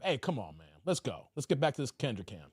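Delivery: low, soft mumble to himself; quiet aside. Real, not acted. Streamer quietly steers chat back to the clip, low and soft.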